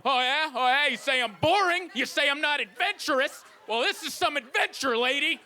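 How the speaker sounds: high, hammed-up indignant shouting; mock yelling back. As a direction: shout back in a comic marital row. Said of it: "I'd be right there yelling back at her".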